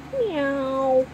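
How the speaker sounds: high rising meows like a small kitten. A narrator's reading. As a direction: mew like a hungry kitten. Kitten meowing again.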